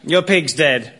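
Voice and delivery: usual speaking voice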